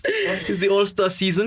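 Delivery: hammy stage actor. Acted. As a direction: stretch it like a showman. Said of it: "don't mind the theatrics".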